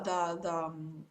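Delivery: ordinary talk, nothing marked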